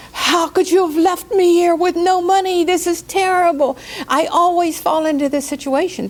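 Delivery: high, loud wailing complaint; blaming cry of despair; frantic complaint. Shown as a demonstration. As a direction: whine at them in panic. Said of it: "That's pushing back".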